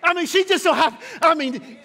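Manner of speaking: loud, high preacher's build; full-force preaching. Acted. Preacher rousing the congregation about mamas, loud preaching.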